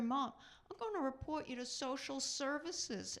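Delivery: mock indignant child tattling; indignant son. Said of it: "He said".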